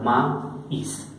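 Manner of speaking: "maíz" split in two, "ma... is", with a pause; vowels separated. Shown as a demonstration. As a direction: slowly demonstrate "maíz" as ma, then is. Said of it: "sino separado". ("maíz" por separado: ma... is)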